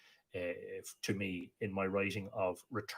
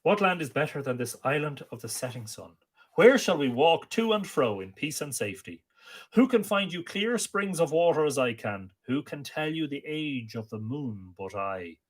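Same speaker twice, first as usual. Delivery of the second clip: poem recitation; 6 semitones higher, 5 dB louder than usual. Acted. Amergin landing on Ireland proclaims his song, declaimed. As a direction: proclaim each line like an ancient bard.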